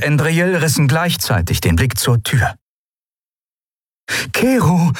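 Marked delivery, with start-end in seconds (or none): 4.3-4.9 high-pitched call of a name. A narrator's reading. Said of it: "rief Endriel". (Ausruf mit hoher Stimme)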